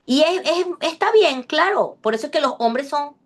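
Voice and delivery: usual speaking voice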